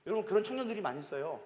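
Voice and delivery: usual speaking voice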